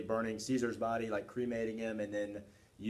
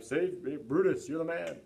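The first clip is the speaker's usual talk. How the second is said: adoring crowd; 6 semitones higher than usual. Retold or imitated. Voicing crowd praising Brutus.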